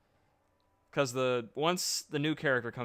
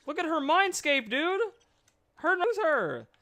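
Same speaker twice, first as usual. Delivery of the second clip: incredulous rant; 6 dB louder than usual. Real, not acted. Mocking disbelief.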